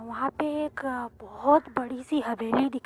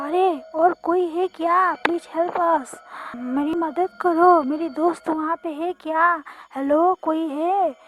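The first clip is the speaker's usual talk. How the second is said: loud, raised calling voice; shouting for help; 3 semitones higher, 7 dB louder than usual. Acted. Narrator begs strangers to help find her friend, shouting. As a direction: call out to anyone who might be inside. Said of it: "ऐसे मैंने चिल्लाना शुरू किया".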